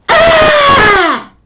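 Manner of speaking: very loud high deliberate scream; angry scream. Shown as a demonstration. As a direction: scream in anger to get your way. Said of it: "because they're angry".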